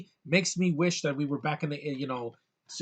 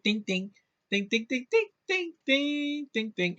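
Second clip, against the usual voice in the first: chirpy dings climbing much higher; Jawa chatter; 10 semitones higher than usual. Retold or imitated. Reviewer imitating the Jawas in the desert scene, high and chirpy. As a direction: chatter like a tiny desert creature. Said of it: "and they go".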